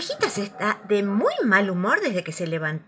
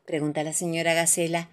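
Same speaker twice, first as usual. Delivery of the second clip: ordinary delivery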